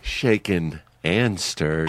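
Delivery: slow, low smoky line; whiskey voice. Acted. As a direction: purr it slow like a lounge charmer. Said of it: "That whiskey voice charmer right there".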